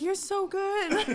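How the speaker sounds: high, drawn-out gushing praise; encouraging mom. Retold or imitated. Mom praising a bad Idol contestant, high and gushing. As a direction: gush slowly, imitating a doting mom. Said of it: "they're like".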